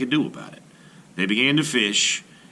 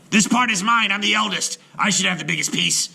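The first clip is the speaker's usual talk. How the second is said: much higher, loud greedy shout; quarrelling shout; 10 semitones higher, 4 dB louder than usual. A narrator's reading. Eldest brother claims the island, angry shout. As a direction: claim the land angrily.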